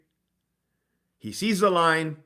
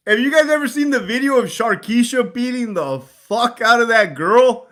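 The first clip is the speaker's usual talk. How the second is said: ordinary delivery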